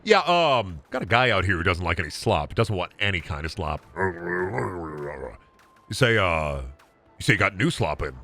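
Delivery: low, hushed mock dealer on the phone; pretend phone call. Slop seller rings his guy about a reluctant customer, pretend phone call. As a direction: play a low-key call to a supplier, lightly.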